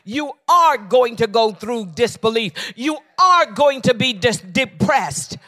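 high, loud preacher's cry; full-force preaching. Acted. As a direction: preach it out to the congregation.

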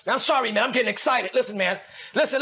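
usual speaking voice

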